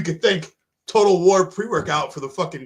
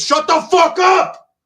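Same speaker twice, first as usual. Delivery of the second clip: real, slow, much higher and louder yell; furious shout; 14 semitones higher, 10 dB louder, much slower than usual. Real, not acted. Host breaks off the podcast to yell at his barking dogs, a real furious shout. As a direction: bellow it across the room to silence the dogs.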